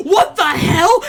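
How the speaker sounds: mock surprise. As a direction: react in disbelief, hammed-up. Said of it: "And he's like".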